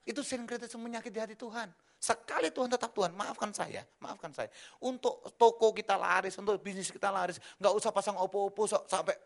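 loud, high preacher voice warning the flock; rising pulpit fire; fiery preaching. Acted. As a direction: thunder it from the pulpit. Preacher warning against syncretism.

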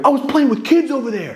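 sudden squeaky retold voice; high-pitched aside. Recalling playing with kids earlier, high-pitched aside. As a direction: throw it in, pitched far up.

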